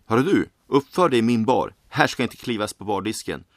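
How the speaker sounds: loud, sharp scolding; angry bartender shout. Acted. Bartender yells at the creature that climbed onto his counter, loud and angry. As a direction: snap at the troublemaker on the bar.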